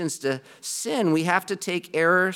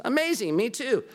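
pitched up high in giddy mock delight; 7 semitones higher than usual. Joke Baptist thrilled to meet a fellow Baptist, high and giddy. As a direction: play the thrilled churchgoer, hammed up.

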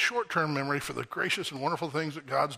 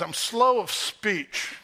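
drawn out, very slow excuse; slow plea; 6 semitones higher, much slower than usual. Retold or imitated. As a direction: stretch every word like a reluctant excuse. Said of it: "He says to God".

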